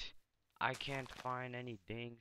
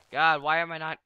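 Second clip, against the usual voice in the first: loud, high frustrated yell; frustrated shout; 8 semitones higher, 15 dB louder than usual. Real, not acted. Frustrated at failing to hit chicken.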